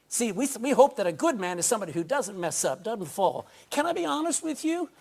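usual speaking voice

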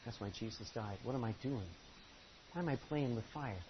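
soft, hushed self-questioning; inner conscience. Retold or imitated. The listener's own conscience speaking, soft and hushed.

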